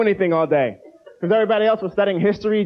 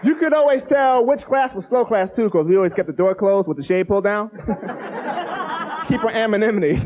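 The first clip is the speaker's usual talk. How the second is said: ordinary talk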